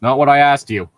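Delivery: interrogator demanding a real answer; stern interrogator. Acted. Interrogator presses captive for answers.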